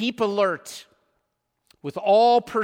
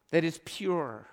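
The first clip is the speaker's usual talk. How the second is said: ordinary delivery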